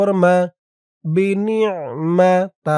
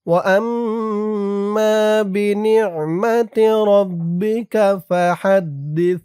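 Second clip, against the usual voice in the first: slow, melodic Quran recitation, stretched vowels; drawn-out recitation; 4 semitones higher, 5 dB louder, much slower than usual. Shown as a demonstration. Teacher reads the whole surah aloud for students to follow. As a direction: chant it slowly with long vowels. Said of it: "আমার সাথে বলুন".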